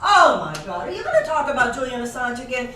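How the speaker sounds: loud, laughing "oh my God" with an eye-roll; hammed-up groan of a bored guest; mock exasperated outcry. Dinner guest groaning at talk of Julian Assange, loud mock exasperation. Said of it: "next time somebody says".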